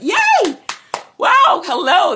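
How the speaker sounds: high, loud, delighted squeal of welcome; excited squeal. Real, not acted. Greeting a live viewer with a thrilled squeal. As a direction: burst out with joy at a guest.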